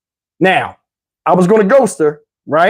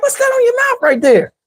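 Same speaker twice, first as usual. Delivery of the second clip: high incredulous question. Retold or imitated. Asking again about mouth.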